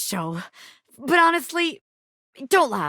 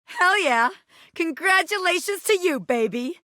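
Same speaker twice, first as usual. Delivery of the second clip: high, bright burst of hype; character whooping triumphantly; excited cheer; 7 semitones higher than usual. Acted.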